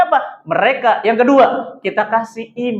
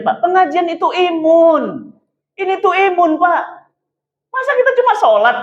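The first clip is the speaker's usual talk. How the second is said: impassioned preaching; 8 semitones higher than usual. Real, not acted. Preacher defends study gatherings to the fathers, impassioned. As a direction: drive the point home to the congregation, pitch soaring.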